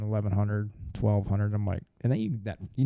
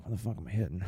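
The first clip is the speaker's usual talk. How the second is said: puzzled muttering; 6 dB quieter, faster than usual. Retold or imitated. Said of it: "I'm like".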